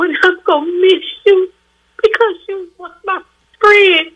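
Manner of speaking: breaking into tears on the last words; real crying. Real, not acted. Caller tells the radio host she will miss him, crying. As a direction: voice rising high as she starts to cry. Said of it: "'cause I got tears".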